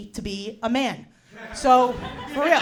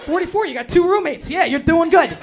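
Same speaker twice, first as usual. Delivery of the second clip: higher, louder hammed-up self-praise; mock affirmation; 5 semitones higher, 7 dB louder than usual. Herself in the mirror, loud mock cheering. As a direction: cheer yourself on with fake pride. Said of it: "say five nice things about myself".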